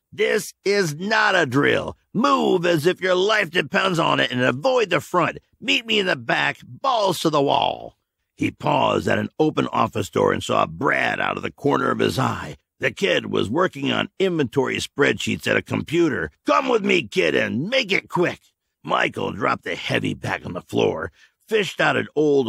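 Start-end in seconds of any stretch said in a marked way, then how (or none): 0.1-8.0 higher, urgent orders, half shouted; a leader pushing his men to run; urgent commander. A narrator's reading. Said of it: "Then he added for urgency". 16.5-18.4 put-on brisk, higher order to a kid; a touch of a hurried officer; commander voice. A narrator's reading.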